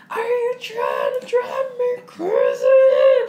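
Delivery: high, loud, drawn-out wail; hammed-up shriek at the end of your rope; mock hysterical yell. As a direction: stretch it into an exasperated scream. Acts out exasperated wife's outburst.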